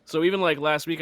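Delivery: ordinary talk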